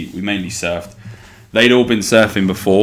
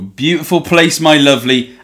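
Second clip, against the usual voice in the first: put-on Cornish accent; 8 semitones higher, 7 dB louder than usual. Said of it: "reason I'm talking like this".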